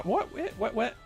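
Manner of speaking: normal talking voice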